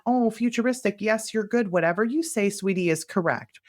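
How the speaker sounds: high, sugary doting parent; mock syrupy parent voice; indulgent parent voice. Shown as a demonstration. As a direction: coo at it like a pushover parent. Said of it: "That's not the voice we want".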